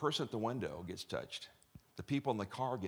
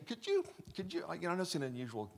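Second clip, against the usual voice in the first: acted request; 8 semitones higher, faster than usual. Asking drive-through clerk, acted.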